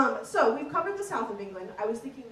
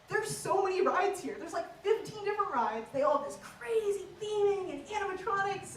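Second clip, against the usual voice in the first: higher-pitched, mildly exasperated listing; incredulous; 6 semitones higher than usual. Retold or imitated. Comic questioning the Yorkshire rider's choice, mildly incredulous. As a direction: argue in baffled disbelief. Baffled retort to the Yorkshire man.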